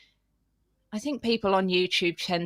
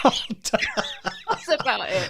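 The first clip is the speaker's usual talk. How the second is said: laughing; 4 semitones higher, much slower than usual. Real, not acted. Laughs after her accent attempt.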